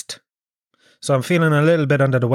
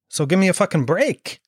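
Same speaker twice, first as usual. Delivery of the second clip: ordinary talk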